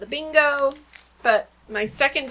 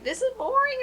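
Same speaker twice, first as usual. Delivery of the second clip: much higher, whining "This is boring"; high, whiny voice of a kid sick of the lesson; 14 semitones higher, 3 dB quieter than usual. Retold or imitated. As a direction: mimic a bored child complaining. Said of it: "if your kids are like".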